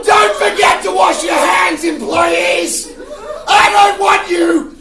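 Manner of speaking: yelling unhinged boss; boss from hell. Pretend. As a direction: manic screaming office boss. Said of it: "this is my impression of a world where I have a secretary".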